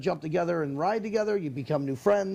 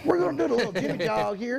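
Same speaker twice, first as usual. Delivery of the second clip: joining in as a Southern surgeon, higher pitched; southern accent; 6 semitones higher than usual. Acted. Joking surgeon explains the operation, mock southern accent. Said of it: "with that southern accent saying".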